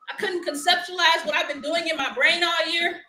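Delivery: ordinary talk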